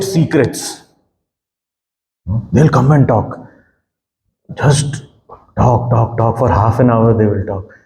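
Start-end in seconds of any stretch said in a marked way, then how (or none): none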